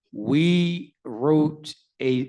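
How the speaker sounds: very slow, deliberate, word by word; speaking slowly. Real, not acted. Lawyer answering an audience question with care, speaking slowly. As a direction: spell it out slowly and carefully. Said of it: "I'm speaking slowly".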